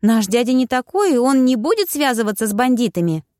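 insist, indignant and high; Bridget protesting. A narrator's reading. Bridget defends uncle. (высокий голос, возмущённо)

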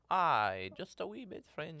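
mock French accent. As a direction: lean into a silly French accent. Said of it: "go a little French".

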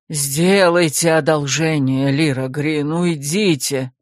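low, slow, weary groan. Acted. Worn-out rector begs the secretary to leave, low and weary. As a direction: beg her to leave, exhausted. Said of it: "простонал ректор".

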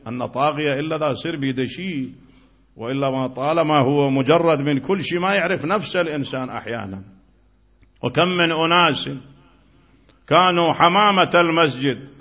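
ordinary delivery

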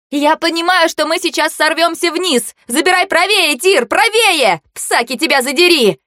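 high-pitched, urgent yelling; panicked shouting. A narrator's reading. Passenger warning driver they will fall, panicked shouting. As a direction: yell directions in rising alarm.

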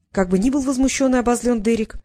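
usual speaking voice

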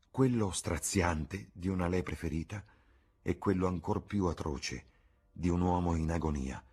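plain reading voice

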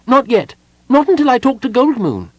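very high, loud little voice; defiant kender. A narrator's reading. Tass refuses to go back with Parlin, defiant. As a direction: refuse loudly in a piping voice.

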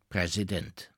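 plain reading voice

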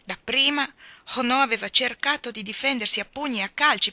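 ordinary delivery, nothing marked

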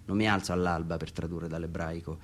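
ordinary talk, nothing marked

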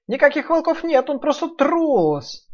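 high mocking piglet, slowing down; scoffing piglet. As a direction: sneer it high, slow at the end. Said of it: "добавил Нуф-Нуф".

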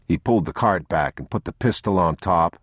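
plain reading voice

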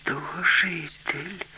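slow, high, drawn-out wonder; girl's realization. Acted. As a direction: marvel slowly as a young girl. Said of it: "зрозуміла Аліса".

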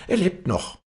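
very high-pitched woman's exclamation; excited call. A narrator's reading. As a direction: announce it in a high, startled voice. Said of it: "rief eins der Weiber".

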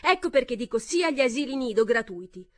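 high, loud start. A narrator's reading. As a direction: raise your voice over them. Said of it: "parlava a voce ancora più alta".

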